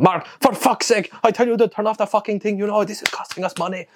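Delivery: high-pitched mock exasperated rant; mad Filipino farmer. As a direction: imitate a farmer flipping out over waste. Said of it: "He would get so mad".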